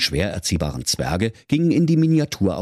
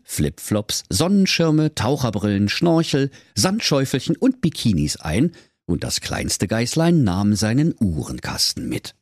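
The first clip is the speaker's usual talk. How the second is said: plain reading voice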